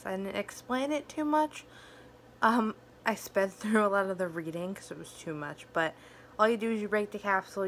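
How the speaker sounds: speaking around fake fangs; lisping with fake teeth; wearing fake fangs. Real, not acted. Seen 2: Fake fangs visible on upper teeth whenever mouth opens throughout.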